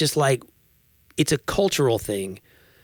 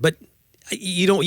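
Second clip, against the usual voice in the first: ordinary delivery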